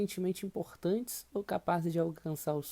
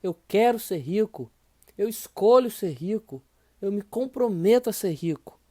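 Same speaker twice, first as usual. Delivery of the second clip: louder, bold, declared with courage; courageous affirmation; 3 semitones higher, 8 dB louder than usual. Shown as a demonstration. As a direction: say it like you mean it, boldly. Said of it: "Diga isso com entonação de coragem, de afirmação".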